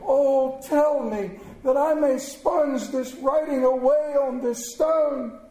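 high, fervent promise; impassioned pleading; agonized vow. A narrator's reading. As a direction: swear it desperately, begging to be spared. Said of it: "And in agony".

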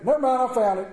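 high, loud, quick call back; sheepish call. Retold or imitated. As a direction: call out that it's found, a little sheepish. Voicing himself finding clip.

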